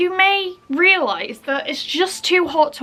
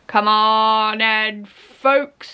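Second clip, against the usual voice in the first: a hint of the fruit seller crying his wares; market trader's call; much slower than usual. Market man calling out to shoppers, slow drawn-out yell. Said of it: "a man yells".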